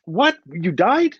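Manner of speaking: high, loud startled "What? What?"; retelling his own shock at her news; stunned disbelief. Retold or imitated. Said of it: "I said".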